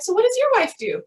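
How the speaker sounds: bright, high chatty question; casual small-talk question. Retold or imitated. Retelling chatting with another physician, asking brightly. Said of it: "I was like".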